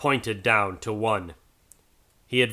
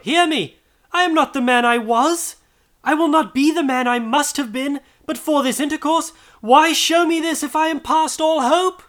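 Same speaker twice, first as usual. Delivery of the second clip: very high, loud, frantic begging; desperate pleading; 6 dB louder than usual. A narrator's reading. Scrooge clutching the robe, pleading frantically. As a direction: beg for your life.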